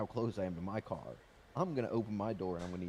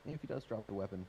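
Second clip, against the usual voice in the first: ordinary talk, nothing marked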